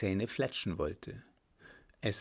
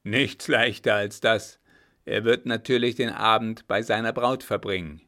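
captain's voice, firmly louder; a louder grim captain demanding a straight answer; loud stern captain; 9 dB louder than usual. Acted. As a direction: press him hard, like a gruff officer. Captain lays out his plan.

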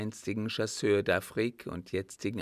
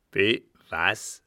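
loud, taken-aback demand; gruff shock; startled old captain; 9 dB louder than usual. A narrator's reading. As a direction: blurt it out at full voice. Startled captain questions servant.

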